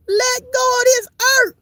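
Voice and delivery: way up high, wailing; high preacher wail. Acted. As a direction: wail it to the congregation. Preacher cry to crowd.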